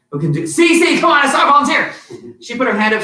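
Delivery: normal talking voice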